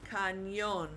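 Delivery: "Cañón" as ka-NYON, stressed on the O; careful teacher's "Cañón" with long stressed O; Spanish stress. Shown as a demonstration. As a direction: say "Cañón" the Spanish way, leaning on the last syllable. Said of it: "putting the stress on that O".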